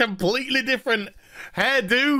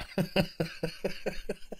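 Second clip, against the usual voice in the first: silent laugh; 12 dB quieter than usual. Real, not acted. Laughing at the show.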